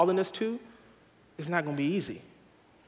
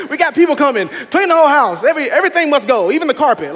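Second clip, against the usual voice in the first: loud, much higher, rushed mimic of the wife; wife demanding the whole house be cleaned, hammed-up loud; mock frantic wife; 10 semitones higher, 13 dB louder than usual. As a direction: ham up the wife barking cleanup orders. Voicing wife's cleaning orders.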